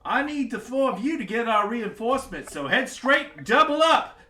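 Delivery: high, loud royal orders; barking eager commands like a giddy monarch; excited king. Acted. King sending the four to the mountains, loud and high.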